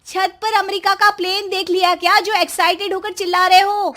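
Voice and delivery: mock his fuss; sarcastic wife. (व्यंग्य करती पत्नी)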